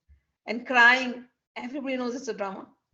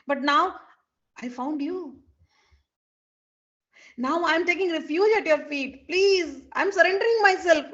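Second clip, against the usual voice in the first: higher, louder, hammed-up plea; dramatic begging; 6 semitones higher, 6 dB louder than usual. Krishna surrendering at Radha's feet, loud dramatic plea. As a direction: throw yourself at her mercy.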